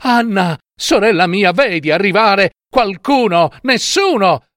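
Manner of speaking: high, urgent calling; anxious cry. A narrator's reading. Wife asks her sister for rescuers, anxious cry. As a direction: call up anxiously for news, high-pitched. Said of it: "e poi gridava".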